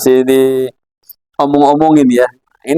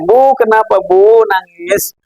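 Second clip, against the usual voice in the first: slow, higher, drawn-out question; concerned neighbour; 4 semitones higher, slower than usual. Acted. Neighbour asks a weeping mother what is wrong, acted concern. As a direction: ask like a neighbour checking on someone in tears. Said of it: "Ditanya sama tetangga".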